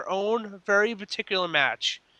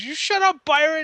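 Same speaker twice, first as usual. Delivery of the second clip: imitated snap, pitched way up and louder; retold heel yelling at a commentator; mock Kevin Owens yell; 13 semitones higher, 6 dB louder than usual. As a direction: bark it at the announcer in a hammed-up yell. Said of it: "he goes".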